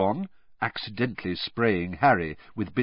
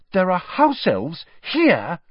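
slow, higher and louder in shock; horror-struck; 10 semitones higher, 6 dB louder, slower than usual. A narrator's reading. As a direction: let the horror dawn as you say it. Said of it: "staring, horror-struck".

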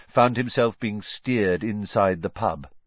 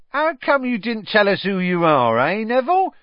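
high, louder teasing conductor voice; chirpy Stan; 11 semitones higher, 6 dB louder than usual. Acted. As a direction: rib him cheerfully like a cheeky conductor. Stan teases Harry.

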